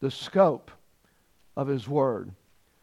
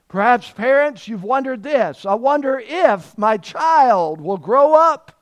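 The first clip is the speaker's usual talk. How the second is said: loud, high, impassioned appeal; full-force preaching; 6 semitones higher, 8 dB louder than usual. Real, not acted. Preacher voices parents' wondering about their child, in loud preaching. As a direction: stretch it out and send it to the back row.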